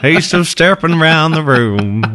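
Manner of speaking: put-on Southern accent. Co-host teasing host's country influence, drawled Texas accent. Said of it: "there's maybe a Southern show".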